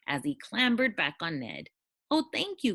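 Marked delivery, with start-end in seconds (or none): 2.1-2.7 higher, thankful dragon; a lightly voiced grateful cry. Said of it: "cried the dragon".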